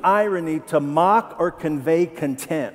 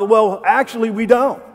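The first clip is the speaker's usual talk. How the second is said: a louder, higher snide reply; sarcastic; 4 semitones higher, 6 dB louder than usual. Acted. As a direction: say it like he's above the question.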